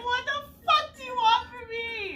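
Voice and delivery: high, slow, frightened cry of a cornered waitress; scared retold outburst. Waitress retelling her scared outburst at a yelling customer, high and slow. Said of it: "I'm, like, so fucking scared".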